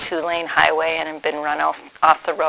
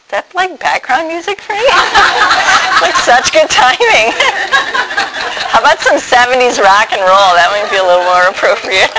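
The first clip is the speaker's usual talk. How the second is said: high, giggling through the words; laughing; 8 semitones higher, 4 dB louder than usual. Real, not acted. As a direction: laugh it out, amused by the timing. Laughs at music playing suddenly.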